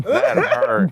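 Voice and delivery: high mock whine. Mock pained reaction.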